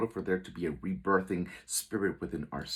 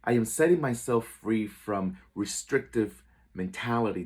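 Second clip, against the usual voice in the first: loud, higher-pitched empowered affirmation; declaration with conviction; 4 semitones higher, 6 dB louder than usual. Shown as a demonstration. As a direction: proclaim it out loud with inner faith. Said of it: "say it with conviction".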